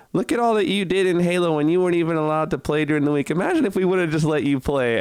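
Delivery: a hint of a proud, apologetic mom; quoting mom. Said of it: "She says".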